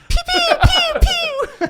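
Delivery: high-pitched pew pew blaster noises; laser pew sounds. Pretend. Player mimics the scarab's blaster shots, high pew noises. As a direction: zap it out like a toy ray gun.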